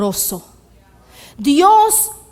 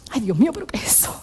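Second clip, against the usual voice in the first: hushed, quick mock dismay; acted disgusted recoil; 3 semitones lower, 8 dB quieter, much faster than usual. Someone opening a fridge on forgotten food, acted disgust. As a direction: recoil from a foul smell, softly and fast.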